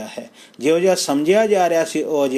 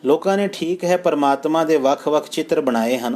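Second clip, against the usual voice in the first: usual speaking voice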